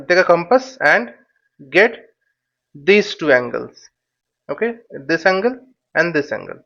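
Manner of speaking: ordinary talk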